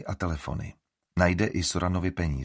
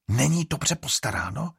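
indignant exclaim; 7 semitones higher than usual. A narrator's reading. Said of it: "zvolala Estrid".

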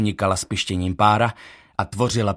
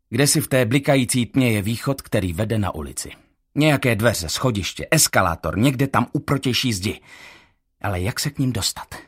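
usual speaking voice

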